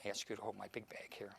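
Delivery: hushed, breathy whisper to the helper; whispered aside. Real, not acted. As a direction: whisper it so the audience can't hear. Whispered aside during magic demo.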